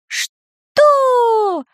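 very high squeaky "what"; not hearing. A narrator's reading. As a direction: squeak back confused. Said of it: "не расслышал Самоделкин".

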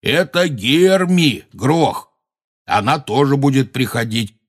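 high, slow, clear presenting voice; coaxing introduction. A narrator's reading. Hagrid presenting Hermy to the giant, coaxing. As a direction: introduce her slowly to a dim listener.

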